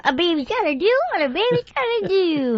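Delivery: high-pitched cartoon baby voice; Tommy Pickles. Shown as a demonstration. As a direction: squeak it out like a cartoon toddler. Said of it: "Tommy Pickles".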